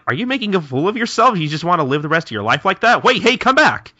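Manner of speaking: high, fast frantic rebuke rising to a call; rant, then call after him; exasperated scolding. Acted. Hichikata calling Kondo back, exasperated scolding.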